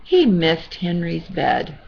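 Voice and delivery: ordinary delivery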